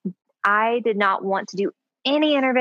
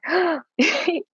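sharp excited intake of breath; a quick, high mock gasp; family gasp; 9 semitones higher than usual. Mimicking her family hearing the news, excited gasp. Family reacts to positive test.

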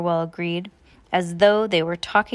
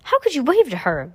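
higher, louder scolding question; mildly hissed reproach; 7 semitones higher, 5 dB louder than usual. A narrator's reading. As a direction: reproach a brother with some heat. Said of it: "Violet hissed to her brother".

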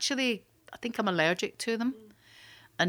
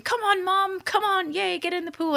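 put-on excited child; 10 semitones higher than usual. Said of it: "he's going".

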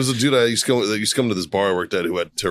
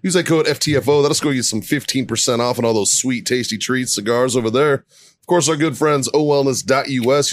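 lightly raised sponsor read; a host reading sponsors, a bit higher; 4 semitones higher than usual. Acted. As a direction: run through the promo codes briskly.

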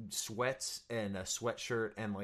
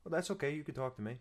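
playing Bob; 5 semitones higher, much faster than usual. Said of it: "you're gonna play Bob".

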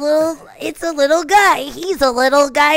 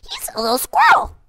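put-on much higher squeaky kid's voice; squeaky squirrel. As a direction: squeak it like a tiny critter. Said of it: "squeaky kid's voice".

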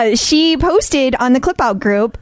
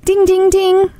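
game show chime; 8 semitones higher, slower than usual. Pretend.

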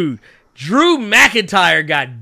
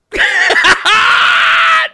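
high, loud shrieking cackle; shrieking laugh; 8 dB louder than usual. Real, not acted. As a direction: burst into a screaming laugh. Reacting to a replay.

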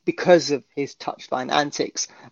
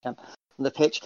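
ordinary talk, nothing marked

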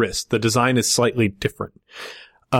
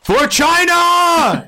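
battle cry; 6 dB louder, much slower than usual. Acted. GM voices the guard's battle cry.

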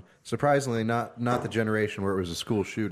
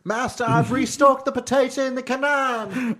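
servant voice; 14 semitones higher, 5 dB louder than usual. Pretend. Servant role bit.

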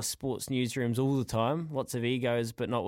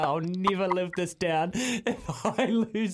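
laughing dread; 5 semitones higher, slower than usual. Retold or imitated. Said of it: "I was like".

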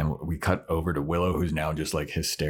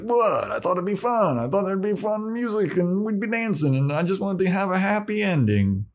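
higher, whiny defensive voice; whiny Xander; 13 semitones higher than usual. Retold or imitated. Xander defending himself to the group, high and whiny. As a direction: whine the excuse up high. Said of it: "he's like".

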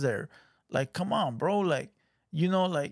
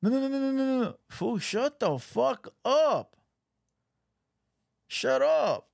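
high-pitched exasperated no's; fed up, telling him to shut up; annoyed; 8 semitones higher than usual. Real, not acted. Annoyed at clip, telling fool shut up.

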